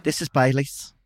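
put-on soft, lightly Liverpool-tinged accent; a hint of a Liverpool accent. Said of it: "do the [name]".

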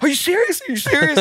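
high-pitched, panicky retold question; hammed-up worried disbelief; mock alarm. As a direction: imitate a friend panicking, pitch shooting right up. Acting out Jordan's panicked reaction.

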